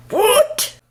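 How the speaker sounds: high, loud mock disbelief; shocked outburst. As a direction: shriek it in comic shock. Shocked reply to wheelchair escort.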